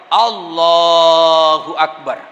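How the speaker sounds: low, very slow, solemn takbir; deep, long-held answer; drawn-out takbir. Acted. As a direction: stretch the words out like a prayer call. Prayer answers angel with takbir.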